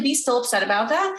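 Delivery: normal talking voice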